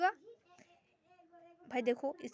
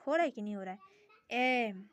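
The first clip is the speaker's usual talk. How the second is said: normal talking voice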